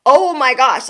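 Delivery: usual speaking voice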